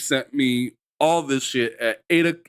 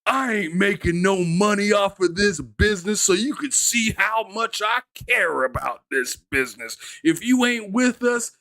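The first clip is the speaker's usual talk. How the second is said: high, raised imitation of a boss; mocking boss voice; 7 semitones higher than usual. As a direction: play the boss who doesn't care. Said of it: "bro is like".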